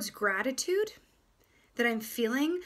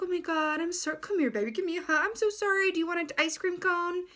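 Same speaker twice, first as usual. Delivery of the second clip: high, gushing comfort, much higher than usual; doting apology to a child; 7 semitones higher than usual. Retold or imitated. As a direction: coo over the child like a guilty parent. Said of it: "You're like".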